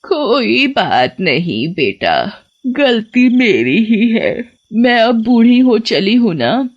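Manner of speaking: put-on slow, quavering old lady voice; frail old woman. Witch posing as old woman to the driver, slow and frail. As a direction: witch faking a gentle granny.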